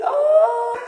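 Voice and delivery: high, sustained mock scream; very high-pitched drawn-out cry, imitating a scream; retold scream. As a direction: re-enact a long squeal of sudden recognition. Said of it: "I screamed".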